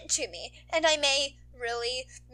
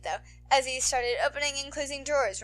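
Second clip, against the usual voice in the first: usual speaking voice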